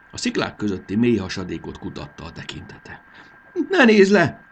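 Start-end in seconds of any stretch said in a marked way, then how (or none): 3.7-4.5 high, loud cry of warning; a fairy's high, loud shout to the boy on the bridge. A narrator's reading. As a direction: call out sharply so he won't look down. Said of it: "kiáltott rá Fanny".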